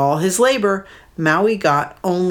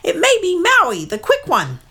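much higher bird voice; mud hen leader; 14 semitones higher than usual. Acted. Leader warning of Maui, high bird voice. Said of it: "the leader cried".

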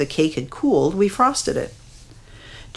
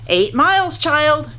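croaky grandma voice; 10 semitones higher, 3 dB louder than usual. A narrator's reading. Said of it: "Grandma croaked".

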